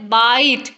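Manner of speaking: बाइट with a long drawn-out आइ; long vowel. Shown as a demonstration. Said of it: "उसका long sound है". (बाइट में लंबा स्वर खींचकर)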